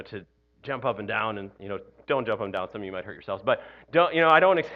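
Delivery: ordinary talk, nothing marked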